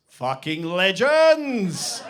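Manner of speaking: slow, loud, drawn-out proclamation; triumphant shout. Real, not acted. Comic to the audience, proudly shouting the punchline. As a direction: bellow it like a victory cry.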